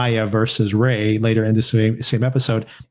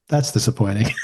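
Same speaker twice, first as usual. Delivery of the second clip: barely react, let it fall flat and dry; understated; 2 semitones lower than usual. Retold or imitated. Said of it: "So understated".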